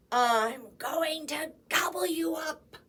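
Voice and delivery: loud menacing troll threat; troll declaring. A narrator's reading. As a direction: boom the threat at the goat. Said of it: "declared the troll".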